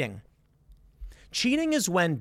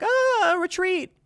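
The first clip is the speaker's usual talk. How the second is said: mock panic; 13 semitones higher, much slower than usual. Militia feigning retreat call.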